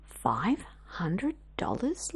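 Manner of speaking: whispered, slow, in disbelief; hushed and amazed; awed whisper. Acted. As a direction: breathe the sum out in wonder. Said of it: "Lizzie repeated".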